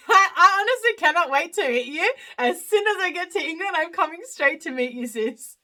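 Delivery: high, giggly delight; laughing excitement. Real, not acted. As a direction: gush to a friend through laughter. Laughing excitedly at a friend's comment.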